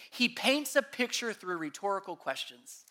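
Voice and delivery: usual speaking voice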